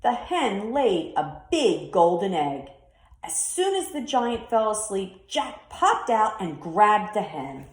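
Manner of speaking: ordinary delivery, nothing marked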